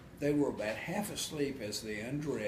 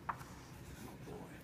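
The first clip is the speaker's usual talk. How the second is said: ordinary delivery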